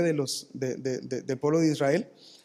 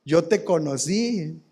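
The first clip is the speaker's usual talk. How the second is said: loud 'Ah', then slow mocking drawl; drawn-out, teasing put-down; jeering drunkard friends; 4 dB louder, much slower than usual. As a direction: scoff like an old drinking buddy. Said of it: "¿Qué te van a decir los borrachitos?".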